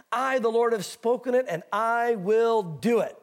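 slow, weighty declaration with each word landed; emphatic. Acted. God declaring his promise to the nations, emphatic. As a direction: stretch out I WILL DO IT with firm emphasis. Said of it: "How emphatic does he need to be?".

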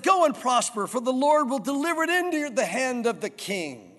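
high, loud, overblown mock prophecy; obvious sarcasm. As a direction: overdo the good news so nobody believes it. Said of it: "he was making it very obvious".

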